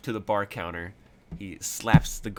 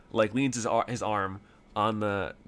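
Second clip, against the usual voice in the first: ordinary talk, nothing marked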